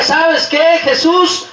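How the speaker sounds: high, urgent appeal; insistent plea. Acted. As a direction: call out over those hushing you. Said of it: "Y él decía". (súplica insistente en tono alto)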